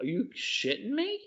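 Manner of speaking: usual speaking voice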